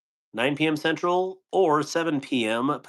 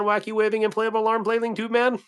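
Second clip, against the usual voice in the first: Wacky waving inflatable arm flailing tube man pitched way up and goofy; silly high voice; 9 semitones higher than usual. Shown as a demonstration. Repeats the tongue twister for chat, in a high goofy voice. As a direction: squeak the phrase like a cartoon pitchman. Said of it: "Man, that's a mouthful".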